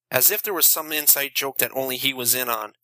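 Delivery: plain reading voice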